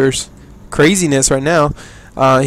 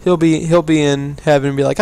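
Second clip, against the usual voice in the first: ordinary delivery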